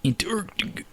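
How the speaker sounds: whispered echo. Pretend. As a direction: breathe the word in a whisper.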